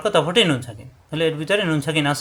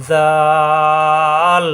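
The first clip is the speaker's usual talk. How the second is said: জাল held very long on a level, louder note; soft, long stretch; 6 dB louder, much slower than usual. Shown as a demonstration. Teacher demonstrates letter length, জাল intoned very long. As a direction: soften the start and stretch জাল for four alifs. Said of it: "নরম করে চার আলিফ টানতে হবে".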